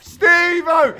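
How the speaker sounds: high, loud cheer; calling out to teammate Steve-O. Real, not acted. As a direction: shout your partner's name in delight.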